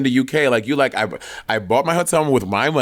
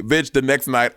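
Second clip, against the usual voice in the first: ordinary talk, nothing marked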